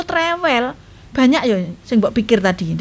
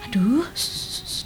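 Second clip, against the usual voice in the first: put-on hushing the baby; 8 semitones higher, 5 dB quieter than usual. Grandma hushing crying baby.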